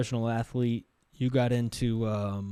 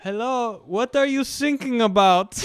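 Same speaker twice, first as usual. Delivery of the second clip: put-on high-pitched, puzzled German-accented question; 10 semitones higher, 5 dB louder than usual. German coast guard misunderstanding distress call, puzzled German accent. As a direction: ask innocently in a mock German accent. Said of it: "but the guy's German".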